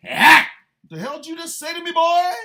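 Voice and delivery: put-on high, loud, fast outburst at the boy; mock confrontation yell. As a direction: scream it in his face, hammed-up. Acted confrontation scream.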